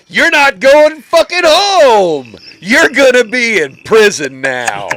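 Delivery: drill-style scare shout, high and loud; mock prison-show yell. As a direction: bellow it slowly at terrified kids, hammed up. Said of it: "the joke at the end for those kids is".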